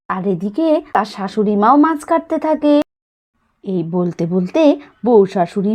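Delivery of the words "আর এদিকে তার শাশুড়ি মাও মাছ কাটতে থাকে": ordinary delivery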